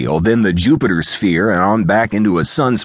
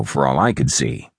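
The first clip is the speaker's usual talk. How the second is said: usual speaking voice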